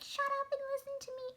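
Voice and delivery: high, loud, fast mock shout from mom; mom yelling. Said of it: "she's yelling at me".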